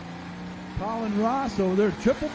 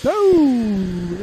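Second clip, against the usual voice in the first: surprised oh; 2 semitones higher, 9 dB louder than usual. Real, not acted. Tractor breaks on the run.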